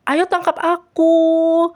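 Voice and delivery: high, loud, drawn-out taunt; playful catch-me call. A narrator's reading. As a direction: dare them to chase you, singsong. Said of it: "sambil mengejek-ngejek Laura".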